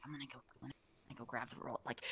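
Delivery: low, fast, very soft mutter; furtive aside. Retold or imitated. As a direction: slip it out quickly under your breath. Sneaking off while coworker is away.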